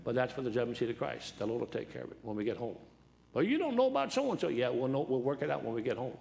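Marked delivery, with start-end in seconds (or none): 3.3-4.5 high-pitched mock protest; mimicked objector.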